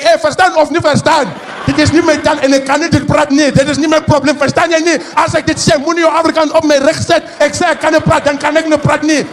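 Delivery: high, loud, exasperated Afrikaans rant; hammed-up indignant scolding; mock outrage. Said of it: "Maar ik zeg".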